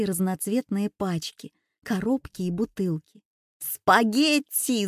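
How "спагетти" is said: drawn-out triumphant shout; big, stretched-out holler; Шахиджан yelling. A narrator's reading. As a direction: yell it slowly, like a grand announcement. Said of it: "заорал Шахиджан".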